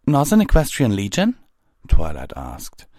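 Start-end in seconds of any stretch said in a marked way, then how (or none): 0.1-1.4 high, loud, quick rising question; puzzled question. A narrator's reading. Twilight questions the captain's legion, high and surprised. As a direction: echo the name, rising in surprise.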